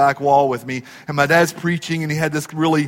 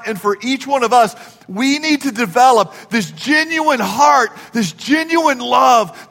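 rising, fast-building preacher drive; preaching; 5 semitones higher than usual. Acted. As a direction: preach it out with swelling force. Preaching about a genuine heart.

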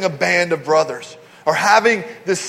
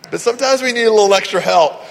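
usual speaking voice

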